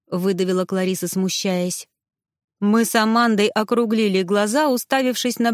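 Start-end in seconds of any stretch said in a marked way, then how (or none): none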